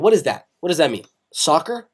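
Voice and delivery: ordinary talk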